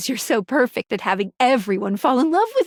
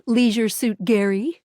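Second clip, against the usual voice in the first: normal talking voice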